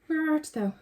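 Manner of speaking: high, lilting call to a lost object; playful high searching voice; mock-archaic searching call.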